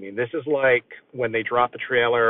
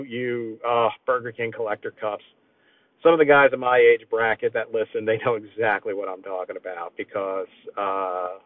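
ordinary talk, nothing marked

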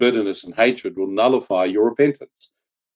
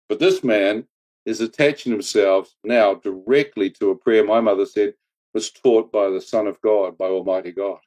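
ordinary talk, nothing marked

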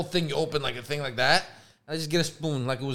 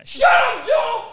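high, loud shout at a friend; playful shout; 7 dB louder, much slower than usual. Real, not acted. Speaker to Joe after his jab, shouting. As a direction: yell to shut him up.

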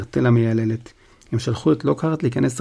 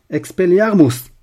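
Expelliarmus! yelled high and loud; spell shout; 6 semitones higher, 8 dB louder than usual. Acted. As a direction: fire the spell out as a battle cry. Harry casts a spell.

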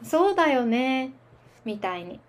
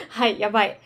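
laughing; 4 semitones lower, faster than usual. Real, not acted. Laughing after own slip.

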